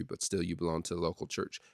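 normal talking voice

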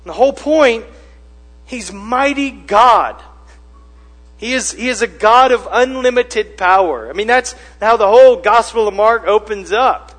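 high, loud, emphatic sermon delivery; full-force preaching. Real, not acted. Preacher proclaiming mighty God to the congregation, forceful. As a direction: drive the point home to the congregation.

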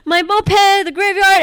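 high, loud, frantic fragments; retold freaking out. Aunt explaining to alarmed relatives, high loud frantic outburst. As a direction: blurt it out in mock hysteria. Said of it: "everyone was freaking out".

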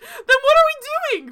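high, loud incredulous outburst; high-pitched mock bafflement; exasperated. Mock exasperation at quirky show logic.

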